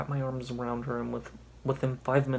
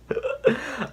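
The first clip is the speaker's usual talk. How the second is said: high loud burst of laughter; 11 semitones higher, 5 dB louder than usual. Real, not acted. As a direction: crack up at the line. Laughs at story line.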